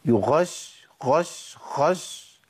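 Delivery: غش said close to خش, in short bursts; breathy ghayn error. Shown as a demonstration. Teacher isolates the mistake, breath making غش sound like خش. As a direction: let the breath run through the ghayn of غش. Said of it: "جري النفس".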